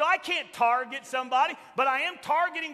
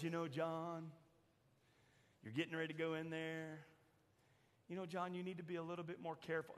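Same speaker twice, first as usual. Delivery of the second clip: soft gentle caution, much softer; Chosen-style Jesus; 8 semitones lower, 15 dB quieter, faster than usual. Retold or imitated. Preacher imitating TV Jesus warning John, in a soft gentle voice. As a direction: counsel him gently, almost hushed. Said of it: "this is Jesus in The Chosen".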